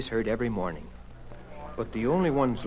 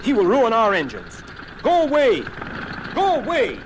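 loud, high outraged yell; alarmed shouting, climbing higher; roaring; 9 semitones higher, 6 dB louder than usual. A narrator's reading. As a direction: roar at an intruder to leave. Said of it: "roared the planes".

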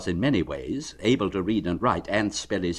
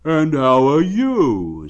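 higher, louder, slow friendly bear voice; cheerful bear; 6 semitones higher, 9 dB louder, slower than usual. Acted. Pooh asking Eeyore how he is, friendly. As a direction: ask it kindly like a plump bear.

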